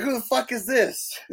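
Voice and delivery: high-pitched retold disbelief; mock incredulous. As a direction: imitate someone baffled by a stranger. Retold reaction to an unexpected newcomer.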